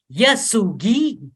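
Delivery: slow, loud group-leading call; leading everyone together. Acted. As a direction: stretch each sound so the group can join. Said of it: "Everyone s-, together".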